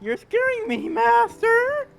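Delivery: usual speaking voice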